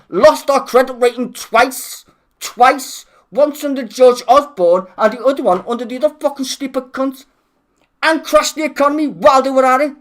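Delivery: heated streamer rant; angry rant. Real, not acted. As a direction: rail against the government. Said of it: "I needed that rant".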